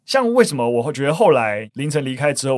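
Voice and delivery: ordinary talk, nothing marked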